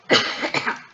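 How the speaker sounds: a real dry cough; coughing fit. Real, not acted. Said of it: "I will be coughing throughout the video".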